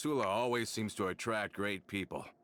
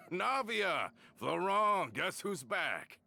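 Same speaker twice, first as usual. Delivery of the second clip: excited calling out; 11 semitones higher, much slower than usual. Acted. Calling out on arrival.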